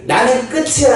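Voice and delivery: high, strained cry of giving up; despairing youth. Retold or imitated. Young people voicing despair about their life, strained. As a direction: sound like someone who thinks it is over. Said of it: "이미 부정적인 생각이".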